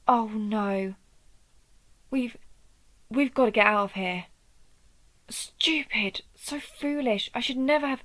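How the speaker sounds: loud, urgent panic, voice jumping up; panicked alarm. Acted. Urging the child to flee the ritual site, in loud panic. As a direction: burst out in panic, cursing yourself.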